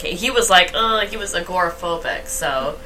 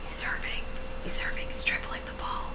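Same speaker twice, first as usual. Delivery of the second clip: whispered commentary; 14 dB quieter than usual. Pretend. Hushed sports commentary.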